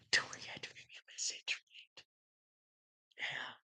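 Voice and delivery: hushed sarcastic whisper; sly whispered aside to the viewer. Real, not acted. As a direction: whisper it like a secret.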